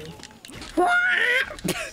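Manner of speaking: high squealing droid shriek; R2-D2 scream. Pretend. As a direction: shriek like a little robot. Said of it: "I used to be able to do the R two D two scream".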